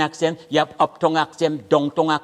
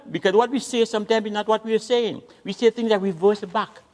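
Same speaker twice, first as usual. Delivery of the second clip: ordinary talk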